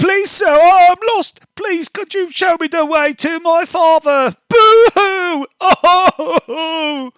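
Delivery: high, loud mock-crying little boy; hammed-up sobbing child. Halfling child fake-sobbing to the guard, hammed up. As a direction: fake sobs, laughably overdone. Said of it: "very dramatically playing the part of the lost halfling child".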